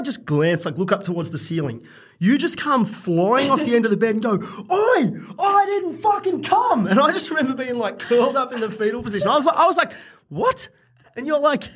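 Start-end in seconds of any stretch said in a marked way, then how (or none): 4.7-6.9 very high, slow squealing protest; hammed-up high-pitched outrage; mock outraged woman. Retelling her outraged protest. 10.3-10.7 mock stunned. His stunned reply in story.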